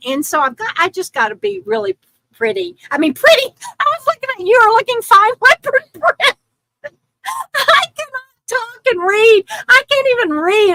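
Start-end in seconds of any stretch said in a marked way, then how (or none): 3.1-10.7 high-pitched, laughing through the words. Real, not acted. Streamer stumbles reading a viewer's comment aloud, laughing helplessly. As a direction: crack up at your own slip. Cracks up over misreading a chat comment.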